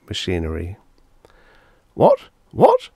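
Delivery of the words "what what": very high, loud, startled stutter. A narrator's reading. Lawyer seeing the stewardess's machinery, stuttering in shock. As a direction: stutter it out in shrieked disbelief. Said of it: "stuttered Mister Bradbury".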